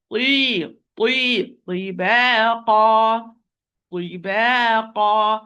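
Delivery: libaqa drawn out slowly with heavy final syllable; long li, then a slow, stretched baqa; proper recitation. Shown as a demonstration. Quran teacher reciting the word properly, libaqa slow and measured. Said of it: "recite this word properly".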